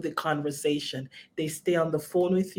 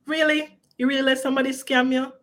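mocking disbelief; 6 semitones higher, 3 dB louder, faster than usual. Mocking what people say to victims.

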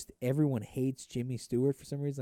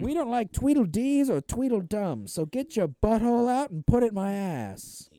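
put-on high, loud old-movie tough guy; tough guy bartender; 10 semitones higher, 6 dB louder than usual. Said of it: "the tough guy bartender".

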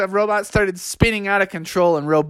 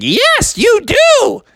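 much higher, loud insistence; firm mom; 4 dB louder, much slower than usual. Acted. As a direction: settle it as mom.

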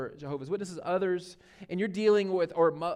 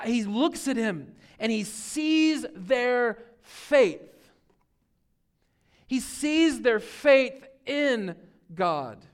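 very slow, weighing each word; slow, loud preaching emphasis; 5 semitones higher, 6 dB louder, much slower than usual. Real, not acted. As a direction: drag out "sees" so it sinks in. Preacher dwells on seeing faith.

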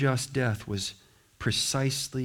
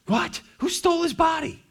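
much higher, yelping in alarm; shocked disbelief; 4 dB louder than usual. Retold or imitated. Disciples reacting to the missing body, shocked yelp. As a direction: squeal it like panicked disciples.

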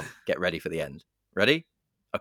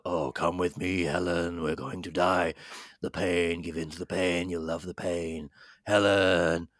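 lightly voiced horror villain, slow lure; a hint of a drawn-out, beckoning monster; Candyman; 2 semitones lower, slower than usual. Podcaster retelling Candyman luring Helen, lightly voiced villain.

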